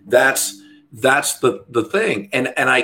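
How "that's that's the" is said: normal talking voice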